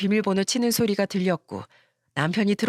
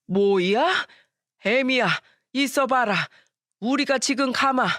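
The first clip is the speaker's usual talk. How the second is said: alarmed in-laws; 4 semitones higher, slower than usual. Acted. In-laws react to the divorce news.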